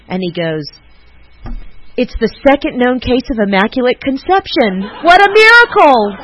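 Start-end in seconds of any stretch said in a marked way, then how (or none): 2.0-6.2 high and loud, bursting into laughter; cracking up at his joke; laughing through the punchline. Retold or imitated. Retelling Bill's miracle quip, laughing.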